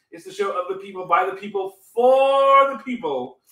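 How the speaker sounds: rising announcer build, stretch the last; ringmaster. Acted. Building host intro.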